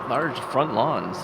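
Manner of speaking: ordinary delivery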